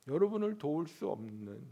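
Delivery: ordinary talk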